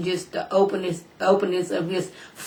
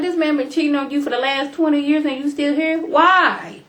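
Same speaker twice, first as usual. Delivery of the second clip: exasperated disbelief; 7 semitones higher, 3 dB louder than usual. Retold or imitated. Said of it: "I was like".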